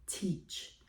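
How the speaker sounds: breathy whispered word teach; whispered command. Retold or imitated. God telling her to become a teacher, whispered. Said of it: "his voice was so pronounced".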